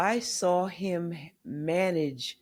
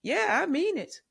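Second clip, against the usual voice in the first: father's blunt reply; 11 semitones higher than usual. Retold or imitated. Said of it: "he said".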